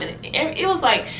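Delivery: ordinary talk, nothing marked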